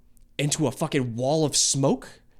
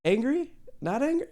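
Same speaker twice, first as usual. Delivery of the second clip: curious questions; 10 semitones higher than usual. Real, not acted. Asking dragon angry or not.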